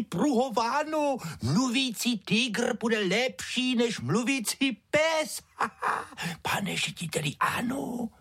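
very high, drawn-out giggling clown. A narrator's reading. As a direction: pitch it way up and laugh between phrases. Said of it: "klaun, který řekl principálovi".

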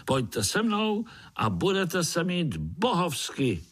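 high-pitched, drawn-out promise; rallying little spider. A narrator's reading. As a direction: entice them in a high, eager voice. Pája rallies the spiders. (lákavě vysokým hláskem)

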